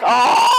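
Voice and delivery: big exaggerated open sigh; loud sigh. Shown as a demonstration. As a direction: let out a long, loud sigh. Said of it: "just sigh a little bit".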